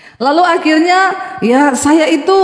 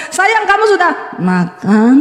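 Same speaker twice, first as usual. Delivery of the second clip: sweet Solo tone; 5 semitones higher, slower than usual. Retold or imitated. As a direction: put on a gentle Javanese sweetness. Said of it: "selalu mengingat-ingat setiap kali ngomong".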